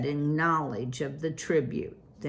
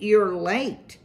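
raised, higher call; crying out; 6 semitones higher, 5 dB louder than usual. A narrator's reading. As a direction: call out over the crowd. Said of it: "he cried".

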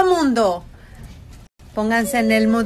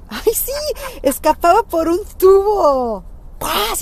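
excited; 9 semitones higher, 4 dB louder than usual. Retold or imitated. Excited retelling of movie escape.

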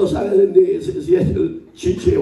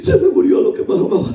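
high, fast wailing sob; mock sobbing; 10 semitones higher, 4 dB louder, faster than usual. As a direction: wail it like a heartbroken old lady. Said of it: "me habla llorando". (sollozo burlón, agudo y rápido)

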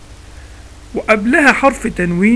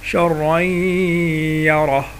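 تلاوة بالإدغام؛ "شرا يره" بطيئة جدًا مرتلة بإدغام التنوين في الياء؛ رتّلها ببطء وأدغم؛ نطق صحيح مرتل بالإدغام; much slower than usual. Shown as a demonstration. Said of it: "ما قلتش شرا".

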